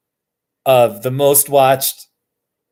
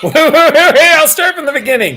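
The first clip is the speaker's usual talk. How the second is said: laughing; 12 semitones higher than usual. Real, not acted. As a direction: giggle through it as you start the clip. Laughing before showing the clip.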